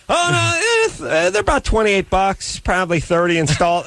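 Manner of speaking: high, lightly voiced know-it-all; mock smug dentist. Patient mimicking the smug dentist, lightly voiced high. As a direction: play the casual expert brushing it off.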